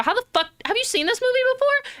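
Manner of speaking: high, amazed disbelief from a stunned friend; baffled friend. Retold or imitated. Stunned friend asking how you guessed the plot, high and amazed. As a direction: squeak it out like a friend who can't believe it. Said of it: "your neurotypical friend looks at you and go".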